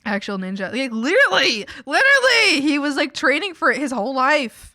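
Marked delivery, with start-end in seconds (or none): 0.7-4.1 rising, louder excited "literally", second one much higher; getting carried away with excitement. Real, not acted. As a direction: gush it with growing excitement. Excited emphasis on brother's change.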